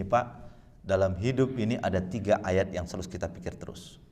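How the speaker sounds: ordinary delivery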